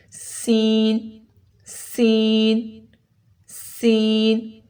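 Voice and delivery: سین held long with a sharp hissed s; whistling sin. Shown as a demonstration. Teacher says do it like this, long whistled سین. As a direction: draw out سین, teeth close together. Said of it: "سین کی میں سیٹی کی آواز آئے گی".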